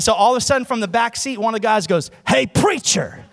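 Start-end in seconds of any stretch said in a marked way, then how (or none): 2.3-3.2 loud, raised teasing holler; mock bully hail; imitated call-out. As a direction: call it from the back seat. Imitated call from back seat.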